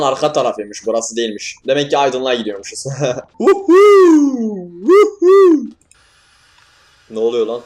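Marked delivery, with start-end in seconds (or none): 3.4-5.7 very high, loud shriek of fright; startled scream. Real, not acted. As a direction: let out a sudden falsetto yelp. Screams at a jumpscare. (çok tiz, yüksek korku çığlığı)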